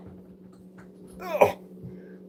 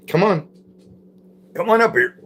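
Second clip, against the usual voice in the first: raised, high call to come up; 11 semitones higher than usual. Real, not acted. Streamer calling Jack up beside him, raised call.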